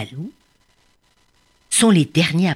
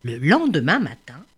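ordinary delivery

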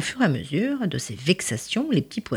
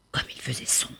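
whisper; 12 dB quieter, faster than usual. A narrator's reading. Dark scary cave.

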